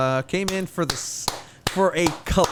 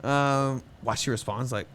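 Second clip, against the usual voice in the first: ordinary talk, nothing marked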